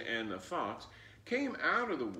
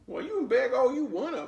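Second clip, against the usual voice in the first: loud, high, cheeky comeback; sassy loud retort; 12 semitones higher, 10 dB louder, faster than usual. Acted. Flossie sasses the fox as she skips off, loud and cheeky. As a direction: toss it back at him, loud and sassy.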